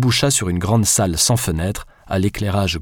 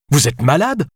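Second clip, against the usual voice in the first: indignant outburst, higher and sharper; narrated furious retort, raised pitch; exploding; 6 semitones higher, 3 dB louder than usual. Acted. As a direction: blurt it out in outrage. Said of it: "explosa Alice".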